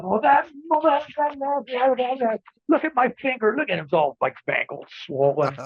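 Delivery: high, whining complaint about a broken finger; whiny Tito impression. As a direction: whimper it like a hurt wrestler. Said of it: "He goes".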